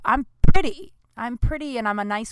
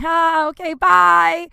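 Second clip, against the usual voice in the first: higher and louder, forced cheery; awkward bye; 8 semitones higher, 7 dB louder, much slower than usual. Retold or imitated. Waitress dodging a winking customer, forced cheery. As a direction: squeak out a polite goodbye. Awkward goodbye after wink.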